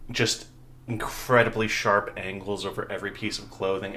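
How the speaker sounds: ordinary delivery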